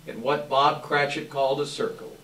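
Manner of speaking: ordinary delivery, nothing marked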